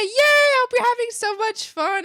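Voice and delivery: high, loud squeal of glee; excited cheering. Real, not acted. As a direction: cheer them on at full pitch. Cheering a viewer's event.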